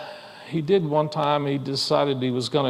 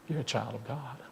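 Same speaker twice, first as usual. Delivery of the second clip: tender reassurance; 10 dB quieter than usual. A narrator's reading. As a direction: say it to lift a hurt child. Preacher reassures the boy.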